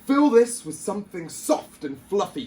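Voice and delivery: loud, high royal bark. A narrator's reading. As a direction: bark the order like an impatient king. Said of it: "he barked".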